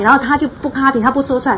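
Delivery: ordinary talk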